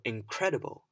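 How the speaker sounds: "incredible" with CRED punched loud; stress on second syllable. Shown as a demonstration. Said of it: "I stressed the different parts".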